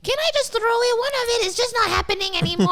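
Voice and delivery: high, fast witchy mom voice; witch voice. Retold or imitated. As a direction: play mom as a shrill witch. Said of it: "make your mom sound like a witch".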